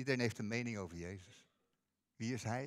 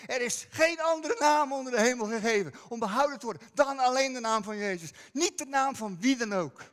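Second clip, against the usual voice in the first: preaching at full force; 9 semitones higher, 10 dB louder than usual. Acted. Preacher telling the church no one is like Jesus, loud proclamation. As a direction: proclaim it with full conviction to the congregation.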